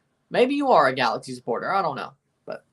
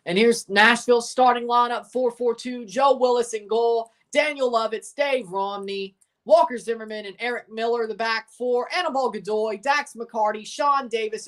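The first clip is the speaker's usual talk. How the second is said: higher, announcer-style list read; lineup announcer; 5 semitones higher than usual. Acted. Streamer reading the Sounders lineup to chat, announcer style.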